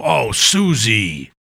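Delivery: slow, drawn-out mock blame; teasing brother. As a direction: drag it out to pin the fart on her. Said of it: "Chris would go".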